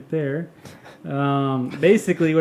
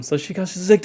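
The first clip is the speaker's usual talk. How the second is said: ordinary talk, nothing marked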